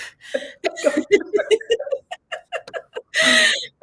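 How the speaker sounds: long, high burst of laughter. Real, not acted. Warm farewell to previous speaker.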